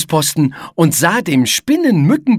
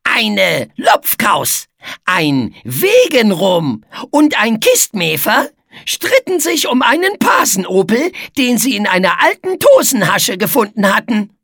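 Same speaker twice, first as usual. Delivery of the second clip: Etze, mischievous storyteller; 7 semitones higher, 4 dB louder than usual. Acted. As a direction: tell a mock little tale to test the listener.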